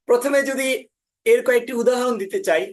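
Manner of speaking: usual speaking voice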